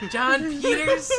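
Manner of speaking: high, loud, slow questioning shout; high-pitched, incredulous kid voice; kid game master. Acted. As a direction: call out the name as a young game master. Kid game master calls on player.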